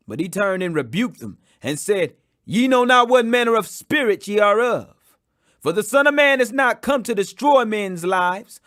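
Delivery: ordinary delivery, nothing marked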